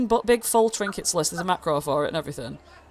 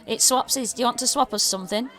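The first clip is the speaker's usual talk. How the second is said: high, fast goblin pitch; goblin trader; 6 semitones higher, faster than usual. Acted. Goblin offering the party a trade, high and fast. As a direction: rattle it off like a pushy little goblin. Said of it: "And he goes".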